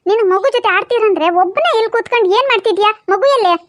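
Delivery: low, quick granny voice giving orders; old grandma voice. Acted. Grandma telling Appu to mind the baby, low and quick. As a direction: play the bossy village grandmother.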